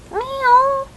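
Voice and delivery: high cat meow. Pretend.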